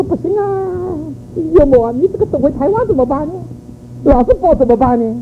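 high-pitched imitation of a worried objection; retold protest. Son-in-law objecting to his mother-in-law about always holding the baby, retold protest.

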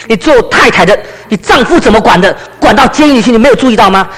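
模仿婆婆高声数落; 婆婆责骂. Acted. 婆婆责骂媳妇. (提高嗓门责问媳妇)

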